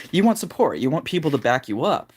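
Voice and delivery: usual speaking voice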